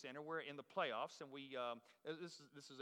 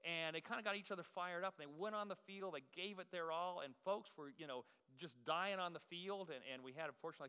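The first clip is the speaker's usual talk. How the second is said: usual speaking voice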